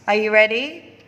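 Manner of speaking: ordinary talk, nothing marked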